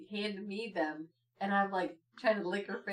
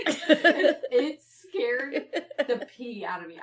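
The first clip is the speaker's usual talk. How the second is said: ordinary talk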